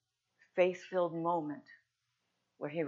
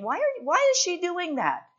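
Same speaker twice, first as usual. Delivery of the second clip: high, loud indignant complaint; scoffing disciples; 10 semitones higher, 11 dB louder than usual. Acted. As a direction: scoff at the waste of money, voice pitched way up. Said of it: "the disciples were scoffing and saying".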